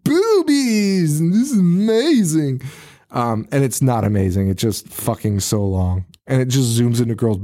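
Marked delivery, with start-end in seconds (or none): 0.0-2.6 high, loud hammed-up whoa; mocking excited guys ogling; mock dudes gawking. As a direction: gawk like a dumb teen at the screen. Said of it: "Brian and Larry being like".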